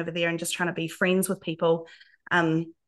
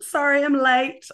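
Kiwi accent; 6 semitones higher, 5 dB louder than usual. Shown as a demonstration. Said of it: "Oh, it's beautiful".

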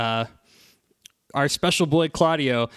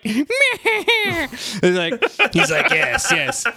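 high, laughing mock robot villain; a hammed-up Transformer villain; Megatron voice; 12 semitones higher, 4 dB louder, much slower than usual. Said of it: "basically Megatron".

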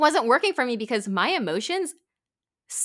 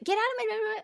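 mock tantrum; 13 semitones higher than usual. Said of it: "It's like".

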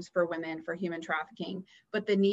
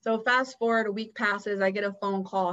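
ordinary talk, nothing marked

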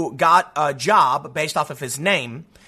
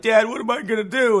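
mock panicking son; 3 semitones higher than usual. Son calling his dad for help, mock panic. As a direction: put on a mildly panicked son. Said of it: "he calls his dad panicking, being like".

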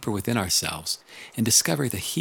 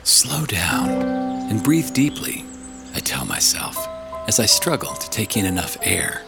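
audiobook narration. Acted. Reads audiobook chapter.

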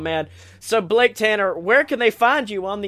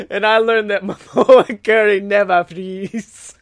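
normal talking voice

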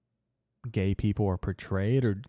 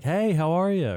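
high, louder imitated friendly hello; put-on cheery hello, pitched way up; smiling greeting; 9 semitones higher, 5 dB louder than usual. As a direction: mimic a smiley passing greeting. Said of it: "she smiled and she was like".

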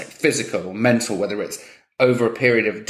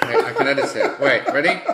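normal talking voice

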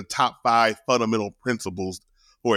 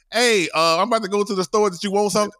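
higher-pitched mom interrupting; imitated mom, pitched up; mom busting in; 9 semitones higher than usual. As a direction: burst into the room with a casual question. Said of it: "busts in and be like".

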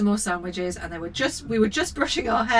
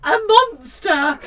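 slow, louder, hammed-up sobbing word; mock sob; 6 semitones higher, 5 dB louder, much slower than usual. A narrator's reading. Mother reading the mum's sobbing line, hammed up. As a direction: sob it out dramatically.

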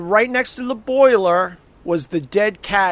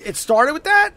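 ordinary talk

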